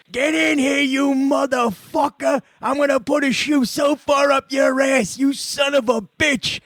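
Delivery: angry dad hollering for his son, hammed up; imitated raging father. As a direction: roar at the kid over a bad report card. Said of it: "he's be like".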